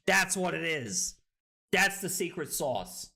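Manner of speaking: usual speaking voice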